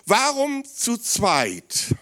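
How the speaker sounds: slow, high, loud rhetorical question; drawn-out question. Real, not acted. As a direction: stretch every word, hold them in suspense.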